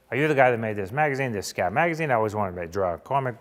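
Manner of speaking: imitated eager fanboy; put-on gushing young artist voice; Kevin Eastman. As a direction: rush it out like a starstruck fan. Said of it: "Only he said it like Kev".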